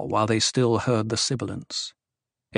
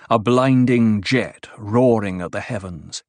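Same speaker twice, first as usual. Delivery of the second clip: plain reading voice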